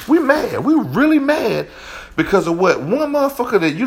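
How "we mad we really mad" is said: emphatic rant. Real, not acted. Ranting about male jealousy.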